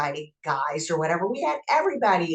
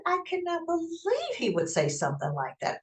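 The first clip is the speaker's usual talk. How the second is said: shocked disbelief; 12 semitones higher, 5 dB quieter than usual. Retold or imitated. Retold shock at a vulgar remark.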